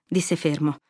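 ordinary delivery

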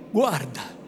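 plain reading voice

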